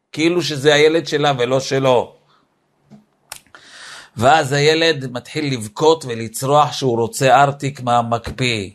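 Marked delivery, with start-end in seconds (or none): none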